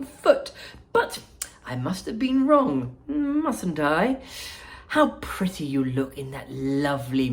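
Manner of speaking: gushing Wonka. Acted. Wonka greeting Veruca Salt, high and gushing. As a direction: fawn over her with sly delight.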